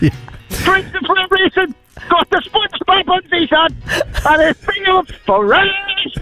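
shivering. Real, not acted. Standing in icy sea water.

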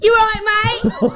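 high, hammed-up English accent; put-on English accent. Shown as a demonstration. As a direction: greet the shopkeeper in a mock English accent. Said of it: "you've got to hear her accent".

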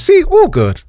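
high-pitched imitation of an unworried mate; breezy reassurance. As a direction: prove there was nothing to fret over, pitched high. Retold friend reassuring him.

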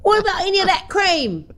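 high, hammed-up British accent; put-on English accent. Shown as a demonstration. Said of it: "you've got a hero accent".

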